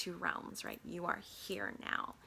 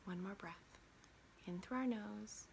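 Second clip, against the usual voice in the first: guided breathing; 5 dB quieter than usual. Acted.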